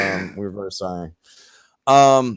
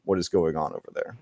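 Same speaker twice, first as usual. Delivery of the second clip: normal talking voice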